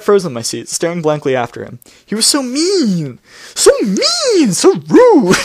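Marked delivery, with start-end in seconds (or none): 2.1-5.4 high, slow mocking whine of a sulky teen; mock whiny whine. Mocking the narrator's whining, a hammed-up pouty whine. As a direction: whine it like a pouting brat.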